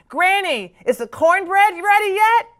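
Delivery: put-on high, loud child's call to Granny; child shouting. A narrator's reading. Child shouts to Granny on arrival, loud and eager. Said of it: "I shout".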